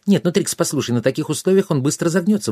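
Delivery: high, hurried fairy protest; indignant fairy. A narrator's reading. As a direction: object quickly in a high little voice. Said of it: "возмутилась фея".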